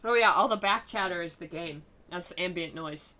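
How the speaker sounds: ordinary delivery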